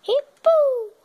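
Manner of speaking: high, loud burst; loud bang. Acted. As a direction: shout the pum like an explosion. Counting then bang. (estouro alto e agudo)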